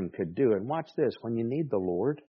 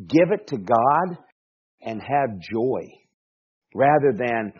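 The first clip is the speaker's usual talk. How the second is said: ordinary talk, nothing marked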